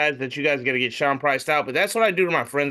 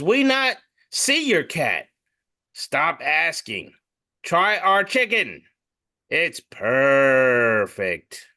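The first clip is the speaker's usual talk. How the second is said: slow deadpan read; much slower than usual. Acted. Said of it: "reading it in a racist accent, but I'm not going to".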